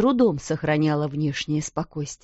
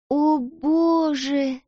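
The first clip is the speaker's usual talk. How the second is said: shocked cry; 8 semitones higher, much slower than usual. A narrator's reading. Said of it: "вскричала Эрмингарда".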